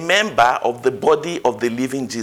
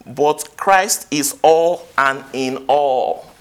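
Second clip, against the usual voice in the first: slow emphatic climax; much slower than usual. Acted. Preacher slows the verse's climax.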